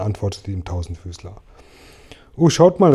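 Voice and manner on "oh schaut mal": higher, louder call to the others; excited call. A narrator's reading. Ladybug calls her friends to look, excited call. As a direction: call out to get everyone looking. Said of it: "rief Marienkäferchen".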